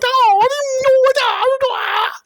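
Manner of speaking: very high, wailing mock martial-arts yowl; mock kung fu cries. Prankster confronting a policeman, shrieking mock kung fu. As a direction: squeal it like hammed-up kung fu. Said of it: "doing some kung fu, going".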